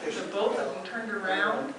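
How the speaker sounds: ordinary delivery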